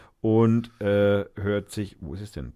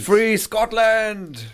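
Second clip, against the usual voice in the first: rallying cry; 11 semitones higher, 6 dB louder, much slower than usual. Pretend. Shouting slogan after co-host.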